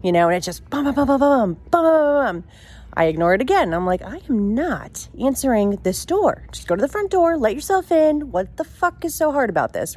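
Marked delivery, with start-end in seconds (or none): 0.7-1.8 high, rapid "bum bum bum" knocks; imitated aggressive knocking. Storyteller imitates someone knocking at the back door, aggressive bursts. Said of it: "knocking gets fucking louder and aggressive". 4.0-10.0 fed up with whoever is knocking; exasperated refusal. Retold or imitated. Teenager refusing to answer the back door, exasperated.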